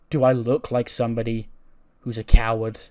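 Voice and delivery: rising to a loud challenge; a defiant retold taunt. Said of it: "She goes".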